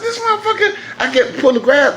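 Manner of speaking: high, laughing frustration; laughing exasperation. Real, not acted. Belt off again, laughing in frustration. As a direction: laugh through the disbelief.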